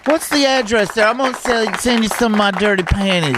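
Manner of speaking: mock flirty lady caller, hammed-up falsetto; female caller voice. Fake caller Cindy flirting with host in hammed-up falsetto. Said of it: "my name's Cindy".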